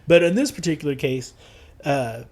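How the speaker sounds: usual speaking voice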